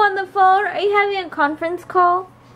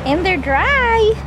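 cheering excited; 8 semitones higher, 4 dB louder, slower than usual. Real, not acted. Cheers at dry laundry.

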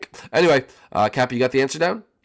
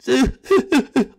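joyful laugh; 12 semitones higher, 5 dB louder than usual. Acted. Laughter after winning.

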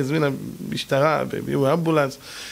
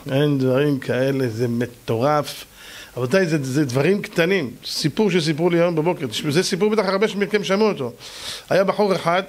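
usual speaking voice

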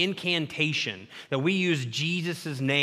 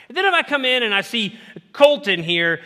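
ordinary talk, nothing marked

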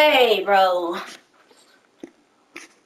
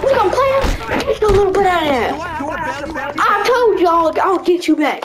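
taunting shout; 7 semitones higher than usual. Real, not acted. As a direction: rub it in his face, laughing. Taunting the rival after revenge.

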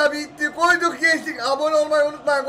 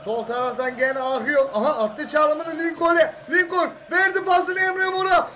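fast excited play-by-play commentary; turkish football commentator; 5 semitones higher, slower than usual. Acted. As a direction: call the match live like on TV. Live play-by-play of a match.

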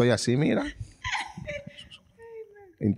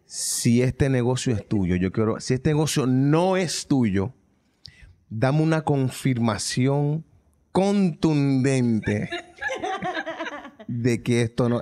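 oración; much slower than usual. Retold or imitated. Oración mental lenta.

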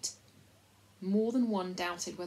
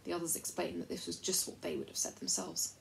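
usual speaking voice

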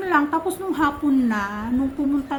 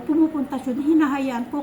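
ordinary talk

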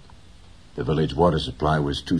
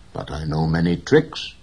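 ordinary delivery

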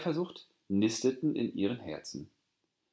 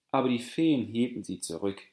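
ordinary delivery

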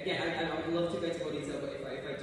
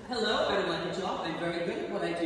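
firm, louder, full-voiced; confident; 5 dB louder than usual. Shown as a demonstration. Coach demoing a confident interview voice. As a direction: state it with command. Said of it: "it's coming across confident".

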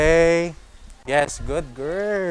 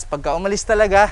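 ordinary talk, nothing marked

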